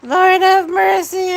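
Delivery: high, squealing delight, drawn out slowly. Real, not acted. Host cracking up at a viewer's joke, squealing like a kid in a candy store. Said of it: "I sound like a kid in a candy store".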